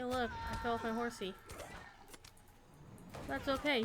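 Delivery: ordinary delivery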